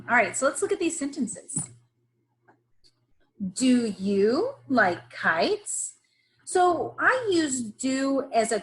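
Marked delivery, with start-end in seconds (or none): 3.5-5.9 "Do you like kites?" said slowly with a rise; careful slow reading. Shown as a demonstration. Teacher modelling a question sentence slowly. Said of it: "Notice that I use the word do".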